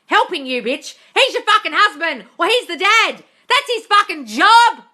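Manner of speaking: furious rant. Real, not acted. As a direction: blast it with outrage. Ranting at quoted wife.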